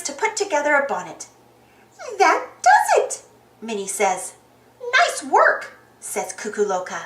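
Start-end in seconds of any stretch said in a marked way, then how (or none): 2.2-3.2 high, loud cartoon voice; high-pitched mouse triumph; triumphant Minnie. A narrator's reading. Minnie finishes bonnet. 5.0-5.6 high cartoon praise; high-pitched bird character; Cuckoo Loca character voice. A narrator's reading.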